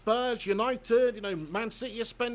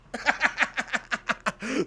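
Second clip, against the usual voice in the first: long high wheezy giggling fit; helpless laughter; 5 semitones higher than usual. Real, not acted. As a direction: laugh uncontrollably at your own joke. Laughing at his own rude impression.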